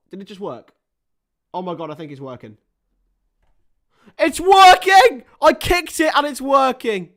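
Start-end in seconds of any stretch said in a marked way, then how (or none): 4.2-7.1 real delighted yell, much higher and louder; excited. Real, not acted. Streamer cheering that the dead charger works, real yell. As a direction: shout it out in triumph.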